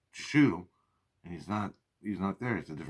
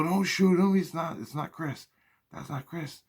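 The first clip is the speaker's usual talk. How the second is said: urgent warning; 10 semitones higher, 4 dB louder than usual. Retold or imitated. Spirits urgently warn him.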